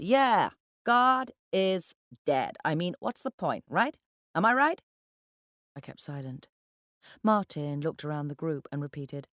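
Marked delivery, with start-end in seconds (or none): 0.0-4.8 high, loud tipsy ranting; talking too loudly. A narrator's reading. Drunken account manager seeking agreement, talking too loudly. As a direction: push it out, pitching up for agreement. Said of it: "talking too loudly".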